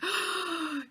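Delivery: high, soft sharp intake of breath; acted stunned gasp; overwhelmed gasp. Retelling her overwhelmed reaction.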